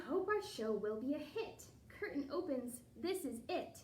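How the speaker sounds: lilting storybook read-aloud; reading to children. A narrator's reading. Teacher reading a ballet picture book to the class, lilting read-aloud. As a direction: read the rhyme to the kids with a bounce.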